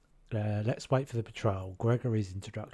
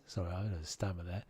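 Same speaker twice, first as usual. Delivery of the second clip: normal talking voice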